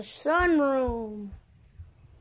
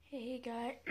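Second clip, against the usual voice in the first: morning voice; 15 dB quieter than usual. Real, not acted. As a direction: croak it out half awake. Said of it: "Morning voice".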